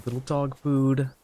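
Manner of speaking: usual speaking voice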